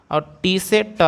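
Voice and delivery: ordinary talk, nothing marked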